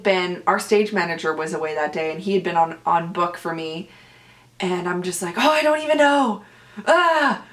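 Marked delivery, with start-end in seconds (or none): 5.4-7.4 higher-pitched, hammed-up despairing wail; retold rant. Actor imitating her rant to a castmate, high and exasperated. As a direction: whine it out, mocking your own meltdown. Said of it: "I was just ranting to him".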